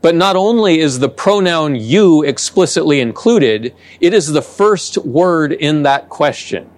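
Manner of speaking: usual speaking voice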